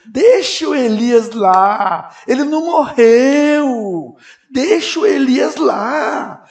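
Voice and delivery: high, loud, pleading preacher's cry; impassioned preaching. Real, not acted. Preacher pleading with the congregation to leave Elijah alone, high and loud. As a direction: preach it at full force, almost crying.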